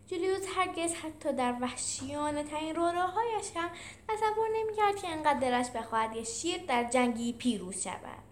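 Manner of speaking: usual speaking voice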